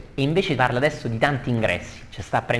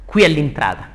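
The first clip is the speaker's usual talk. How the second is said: raised, louder declaimed cry; Fleggias; 4 semitones higher, 6 dB louder than usual. Acted. Ferryman Fleggias to his passengers, declaimed cry. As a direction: call it out as a curt announcement. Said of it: "gridò".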